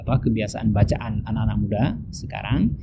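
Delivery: ordinary talk, nothing marked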